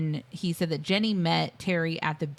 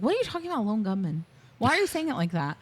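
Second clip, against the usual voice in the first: high-pitched incredulous questioning; mock bafflement; 5 semitones higher, faster than usual. Podcaster playing Terry puzzled by the lone gunman, mock bafflement. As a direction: question it in exaggerated confusion, voice jumping up.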